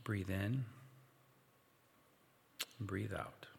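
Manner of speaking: hushed meditation guide; guided meditation. Acted. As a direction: lead them gently through the imagining. Guided imagination exercise.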